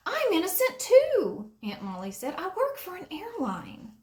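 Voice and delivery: high, loud aunt voice; Aunt Molly protesting to the officer; high indignant aunt. A narrator's reading. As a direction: insist on your innocence.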